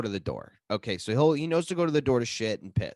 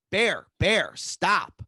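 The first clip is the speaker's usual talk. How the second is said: loud, higher scolding at a dog; re-enacted yell at a pet; retold yelling; 6 semitones higher, 6 dB louder, much slower than usual. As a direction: call sharply to the dog to stop. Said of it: "I'm yelling at the dog".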